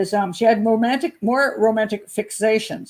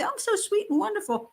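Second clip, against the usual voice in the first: much higher, softer, sugary mock innocence; mock sweet voice; 11 semitones higher, 5 dB quieter than usual. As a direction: parody her saccharine act. Mocking her sweet public persona.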